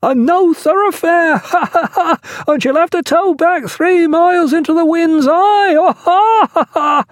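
high, drawn-out shout broken by laughter; lookout's hail. A narrator's reading. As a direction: call down gleefully from the masthead. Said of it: "hailed the lookout".

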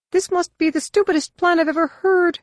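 very high, quick alien voice; Droma's retort. Acted. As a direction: snap back in a squeaky alien voice.

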